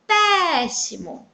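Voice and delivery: slow "péssimo" with a long PÉ; "péssimo" drawn out on its stressed opening; stretched stressed syllable. Shown as a demonstration. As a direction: lengthen the first syllable of "péssimo". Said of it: "foi pronunciada durante mais tempo".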